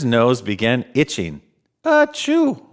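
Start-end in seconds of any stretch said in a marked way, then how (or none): 1.8-2.7 a big reindeer sneeze. A narrator's reading. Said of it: "went Dasher".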